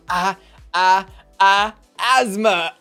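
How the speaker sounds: loud, drawn-out gasping "Ah, ah, ah, asthma"; mock asthma gasps. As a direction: wheeze out each "ah" like an asthma attack. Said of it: "when she says".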